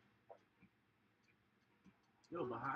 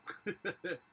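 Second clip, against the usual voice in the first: loud laugh; 7 semitones higher, 7 dB louder than usual. Real, not acted. Knocked out in game.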